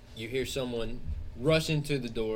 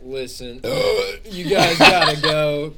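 much louder, exasperated scolding; fed-up clerk; 4 semitones higher, 10 dB louder than usual. Acted. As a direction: throw the guests out, done with them. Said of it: "so fed up".

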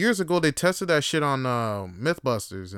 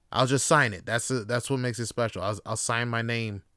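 ordinary talk

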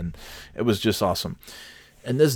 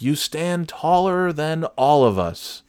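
higher, slow, imitated earnest tribute; insistent repeat; 7 semitones higher, slower than usual. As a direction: raise the pitch and say it slowly for someone who can't hear. Man repeats louder to hard-of-hearing woman.

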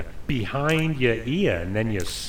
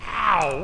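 yelp of pain; 6 semitones higher, 4 dB louder than usual. Acted. Struck by his brother.

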